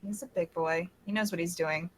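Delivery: soft, careless shrug of a line; not giving a shit. Acted. Character shrugging off leaving Al behind, indifferent. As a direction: toss it off quietly, indifferent. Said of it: "like, not giving a shit".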